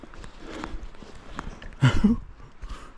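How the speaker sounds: shaky relieved laugh after a near fall; relieved breathy laugh. Real, not acted.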